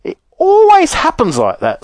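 very high-pitched, exasperated lament; acted sigh. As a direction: wail it in frustration as Wonka. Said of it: "sighed Mister Wonka".

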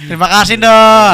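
mock loud thanks.

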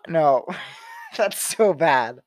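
ordinary delivery

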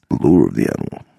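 very deep sultry voice; sensuous mimic. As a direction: growl it low and seductive. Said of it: "it gets all, um, sensuous".

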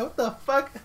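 high, loud baffled listener voice; incredulous, laughing a bit. Retold or imitated. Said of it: "people probably be like".

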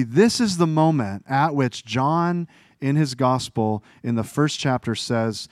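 ordinary talk

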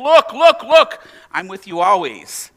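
Jesus urgently calling the disciples to look; emphatic. Retold or imitated. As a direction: call "look" urgently, then slow down. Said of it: "Jesus is saying ... emphatic".

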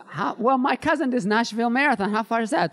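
high-pitched imitation of a clueless non-runner; persistent naive questioner.

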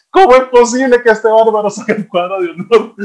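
high, loud incredulous exclamation; amazed disbelief. Retold or imitated. A father amazed his son made the honor roll, high and loud.

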